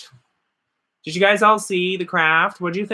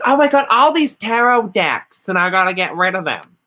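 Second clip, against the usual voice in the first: a hammed-up, badly faked Southern US accent, higher and louder; 3 semitones higher, 4 dB louder than usual. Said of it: "That's a really bad Southern accent".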